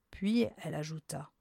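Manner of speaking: usual speaking voice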